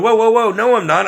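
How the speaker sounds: mock-alarmed stop-right-there; startled refusal. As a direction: pull back in alarm at the offer.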